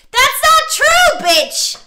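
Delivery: very high, loud, stretched-out denial; outraged yell. Real, not acted. Player denying the partner's accusation about virginity, outraged. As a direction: shriek it slowly in outrage.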